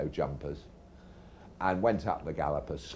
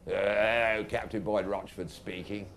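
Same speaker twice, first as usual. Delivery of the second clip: put-on Captain Boyd Rochford; 4 semitones higher than usual. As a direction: answer a late-night call, stretching out the hello. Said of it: "and the voice said".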